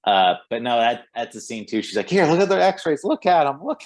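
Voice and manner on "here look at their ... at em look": high, loud, insistent "look at 'em"; half-playful push to make him look; frantic urging. Retold or imitated. Woman at the bar urges him to see X-rays, mock frantic. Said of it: "just frantically trying to get him to look".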